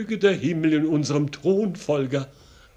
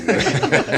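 amused laugh; 5 dB louder than usual. Acted. Amused laugh at witty remark.

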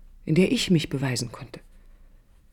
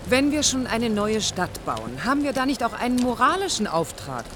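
heated appeal; 5 semitones higher than usual. Acted. Heated argument with the boss.

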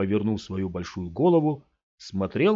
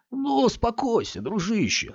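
much higher, falsely gentle; calm him like a pet; soothing stranger; 13 semitones higher than usual. A narrator's reading. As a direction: driver hushing frightened boy. Big man soothing Scott.

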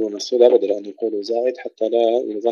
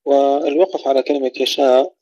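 ordinary delivery

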